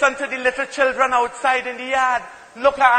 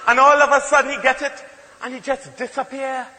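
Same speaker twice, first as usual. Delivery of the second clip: normal talking voice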